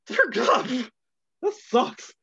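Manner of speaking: high, mushy numb-mouth voice; numb tongue. Real, not acted. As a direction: talk past a numbed tongue. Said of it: "You sound like Daffy Duck".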